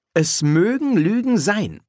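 pointed, insistent rebuke; sharp. A narrator's reading. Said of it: "sagte Polly scharf".

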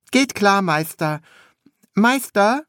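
high, eager apprentice voice; high-pitched apprentice calling his master; young apprentice. A narrator's reading.

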